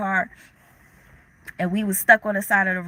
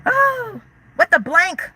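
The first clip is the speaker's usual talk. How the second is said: very high startled squeal into a louder question; a troll is back in the chat, startled outrage; shocked; 9 semitones higher, 3 dB louder, slower than usual. Real, not acted. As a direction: caught off guard by an unwelcome comment. Reacts to a troll returning.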